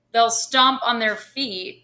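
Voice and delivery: normal talking voice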